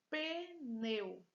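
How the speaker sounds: pneu stretched into pe-neu; syllable by syllable. Shown as a demonstration. Teacher presenting a word to students, pneu stretched as pe-neu. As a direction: sound out pneu in two beats. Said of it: "Duas sílabas".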